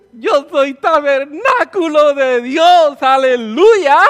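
slow, loud, laughing and crying at once; tearful joy. Real, not acted. Preacher overwhelmed before congregation, tearful joy. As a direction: overcome with emotion, almost sobbing.

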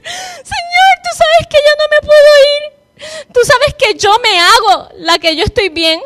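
high, slow, tearful pleading to God; tearful plea. Retold or imitated. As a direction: beg the Lord through tears, slowly. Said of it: "llorando en la bañera". (súplica llorosa, aguda y lenta)

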